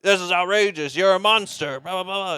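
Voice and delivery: loud, high mock outrage at the boss; mock outraged yell. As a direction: yell it like a hammed-up old man storming in. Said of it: "and starts yelling".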